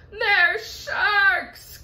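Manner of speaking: high, loud alarmed cry; frightened cry. Acted. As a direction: cry out in panic. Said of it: "cried the earthworm".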